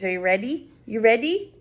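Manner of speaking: ordinary talk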